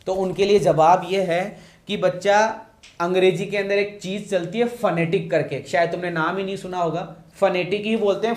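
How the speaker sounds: normal talking voice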